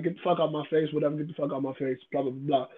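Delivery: mimicking a quiet, quick brush-off; dismissive brush-off. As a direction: toss it away like she can't be bothered. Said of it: "just passing it off".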